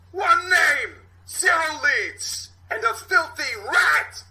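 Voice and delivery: captain erupting in fury; terrifying rage. Acted. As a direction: explode at your detectives. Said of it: "goes from pleasant to terrifying".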